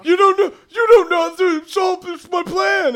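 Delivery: very high, loud blubbering; squeaky mock-hysterical protest; hammed-up crying. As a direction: wail a desperate excuse. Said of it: "and Thaddeus starts crying".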